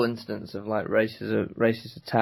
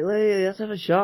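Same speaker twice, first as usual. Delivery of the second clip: retold cajoling; 8 semitones higher than usual. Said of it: "We were like".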